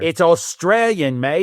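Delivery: put-on slow, high, drawn-out Australian accent; mock Australian accent. Contestant answering the quiz in a mock Australian accent. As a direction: stretch it out in a playful Aussie accent. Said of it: "Arnie and I should do accents".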